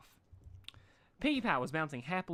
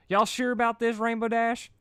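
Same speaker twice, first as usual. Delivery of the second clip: Southern pony voice; Applejack voice; 12 semitones higher, 7 dB louder than usual. Acted. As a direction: voice the country mare up high and loud.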